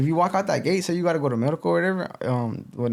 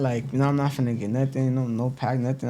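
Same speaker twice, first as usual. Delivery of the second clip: ordinary delivery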